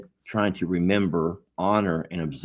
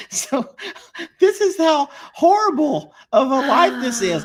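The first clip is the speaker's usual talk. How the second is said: high laughing complaint, louder by the end; laughing exasperation; 5 dB louder than usual. Real, not acted. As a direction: laugh through the frustration.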